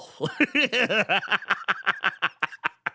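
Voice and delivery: long staccato belly laugh, starting high and trailing down. Real, not acted. Man cracks up at the name Manimal, long helpless laugh. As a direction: crack up helplessly in a run of quick ha-has.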